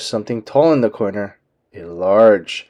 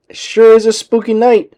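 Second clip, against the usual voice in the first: nervous; 10 semitones higher, 4 dB louder than usual. A narrator's reading. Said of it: "Daisy giggled nervously".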